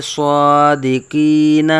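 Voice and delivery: very slow, loud recitation of Sadequain with long held vowels; Quranic recitation. Shown as a demonstration. Teacher reciting a written example of stopping, slow drawn-out recitation. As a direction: stretch both long vowels of Sadequain, intoned. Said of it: "جیسا کہ یہ لکھا ہے".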